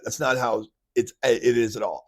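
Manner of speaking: ordinary delivery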